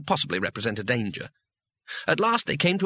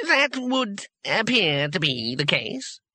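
high, slow, stretched character voice; stiff old wizard; 8 semitones higher, slower than usual. A narrator's reading. Trapped wizard answers through the grill, stiffly. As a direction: concede it with pained formality.

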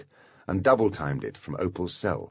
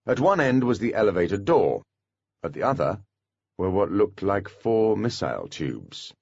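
ordinary delivery, nothing marked

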